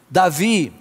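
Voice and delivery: ordinary talk, nothing marked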